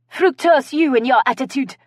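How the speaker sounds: high, loud comeback; sharp retort. A narrator's reading. Guest retorts to the snide remark, high and loud. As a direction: fire back in character. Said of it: "Kaylee retorted".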